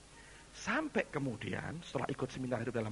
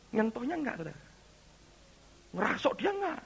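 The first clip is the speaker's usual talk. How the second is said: ordinary talk, nothing marked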